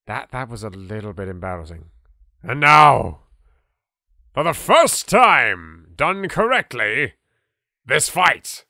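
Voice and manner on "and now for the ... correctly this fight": slow, booming fight announcer; grand announcement. Acted. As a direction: announce the big bout with drawn-out, punched words. Host grandly announces the boss fight.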